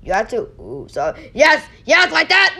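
normal talking voice

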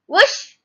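short, loud voiced whoosh; whoosh sound. A narrator's reading. Narrator voices the arrow flying at the target, loud whoosh. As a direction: let out a sudden whoosh effect.

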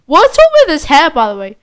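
usual speaking voice

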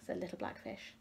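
usual speaking voice

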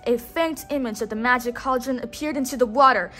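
ordinary delivery

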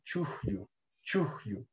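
"chuyu" with only a faint breath between chu and yu; soft "chuyu", the middle Q barely there; barely audible Q. Shown as a demonstration. Teacher showing Xauxahuanca Quechua, imperceptible Q. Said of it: "realmente de modo imperceptible, el sonido de la Q".

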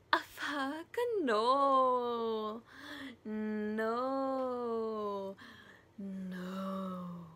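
drawn-out mock-dramatic no, fading off; emphatic refusal. Lolita turning Humbert down, emphatic drawn-out refusal. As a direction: stretch each no out long, starting loud.

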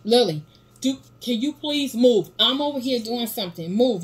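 loud, high scolding; calm and firm. Real, not acted. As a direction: order her out, firmly and loudly. Mom scolding child in kitchen.

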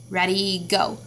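ordinary talk, nothing marked

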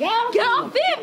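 high-pitched yell in a scuffle; urgent protest. Acted. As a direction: yell to break up a fight. Scuffle over the phone.

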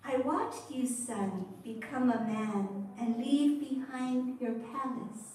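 ordinary delivery, nothing marked

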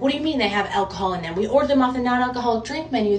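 retold panic, words tumbling out fast; panicked rush. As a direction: rattle it off like you're freaking out. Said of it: "I'm, like, freaking out".

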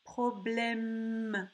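"Problème" with a long final m; stressed M sound. Shown as a demonstration. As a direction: hum out the end of "Problème". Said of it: "on entend le son M".

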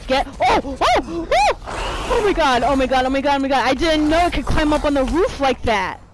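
loud, high terrified screaming. Real, not acted. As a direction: scream in real panic. Monster climbs onto roof.

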